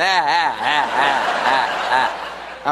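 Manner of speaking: mimicked ha-ha taunt; mocking laugh. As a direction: laugh at him like a friend in a Mercedes. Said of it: "is laughing at me going".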